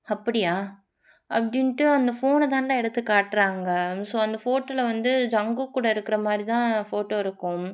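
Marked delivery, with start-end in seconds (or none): none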